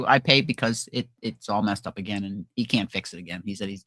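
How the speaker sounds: ordinary delivery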